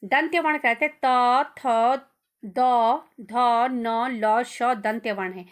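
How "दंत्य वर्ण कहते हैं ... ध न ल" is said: dental letters त, थ, द, ध said louder, one by one; sound demo. Shown as a demonstration. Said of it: "देखो अब मैं जब बोल रही हूँ".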